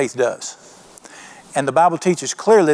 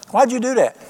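a lightly voiced, puzzled question, pitched higher; 6 semitones higher than usual. Preacher voicing Jesus asking Peter, mildly puzzled. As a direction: ask it as if honestly baffled. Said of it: "Jesus seems puzzled".

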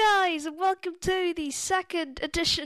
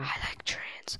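soft breathy whisper; 12 dB quieter, slower than usual. Pretend. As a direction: whisper it deadpan. Whispered meme line.

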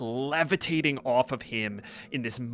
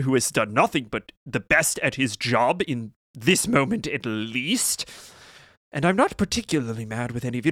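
higher, clipped restraint over anger; stern wizard Ganbald; 5 semitones higher, 6 dB louder than usual. Acted. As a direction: insist you're not mad while clearly mad. GM voices Ganbald scolding the party.

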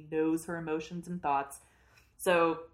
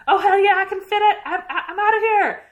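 much higher, louder, thrilled escape cry; excited serpent; 13 semitones higher, 12 dB louder than usual. Retold or imitated. Serpent squealing as it tries to escape. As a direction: squeal like you're finally free.

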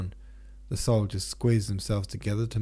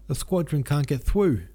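higher and louder, a mock shout; 7 semitones higher, 4 dB louder than usual. A narrator's reading. Denisov to Nesvitsky across the bridge, a higher mock shout. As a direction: call it over the crowd, half-hammed. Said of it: "shouted Vaska Denisov".

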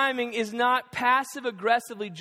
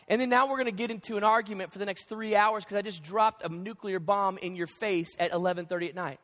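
normal talking voice

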